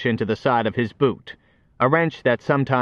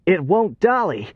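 panting, higher and breathless; out of breath; 7 semitones higher than usual. Acted. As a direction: gasp it out after running. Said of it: "panted the foreman".

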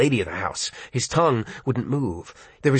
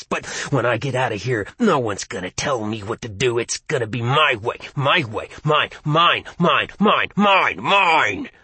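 possessive snarl. Acted. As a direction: growl it, hungrier each time. Possessed voice claiming ownership.

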